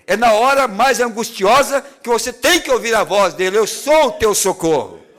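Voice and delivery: rising from steady preaching to a near shout; preaching, building to a peak. Acted. Preacher urging listeners to hear God's voice, building to a near shout.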